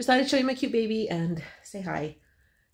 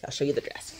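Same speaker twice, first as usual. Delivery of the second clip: whisper; 6 semitones lower, 5 dB quieter, faster than usual. Real, not acted. Aside whispered.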